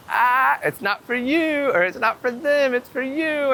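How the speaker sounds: imitating a lofty high spirit voice. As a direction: float it up high and slow like a spirit speaking. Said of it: "Spirit's like".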